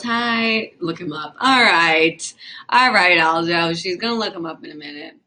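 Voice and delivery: ordinary talk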